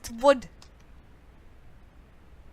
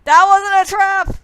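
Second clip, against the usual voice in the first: indignant shout; 7 semitones higher, 11 dB louder than usual. Real, not acted. Game kills player, outburst.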